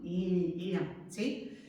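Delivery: usual speaking voice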